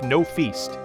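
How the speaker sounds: ordinary delivery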